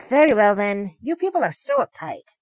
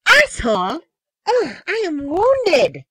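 high, drawn-out fake hurt; slow, high-pitched mock offence; slower than usual. A narrator's reading. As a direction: feign being wounded, theatrically. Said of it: "Yuya continued on mockingly".

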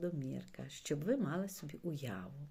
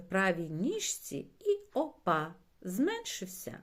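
animated, louder storyteller voice; story reading to children; 7 dB louder than usual. A narrator's reading. Reading a pond adventure to children, animated storytelling. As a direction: read it out lively for young listeners.

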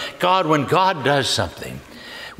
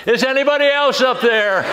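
high-pitched, comic call upward; mock-hopeful shout for a second opinion; doubtful calling up; 8 semitones higher than usual. Climber rejecting God's offer, calling up in comic doubt. Climber calls up for another rescuer.

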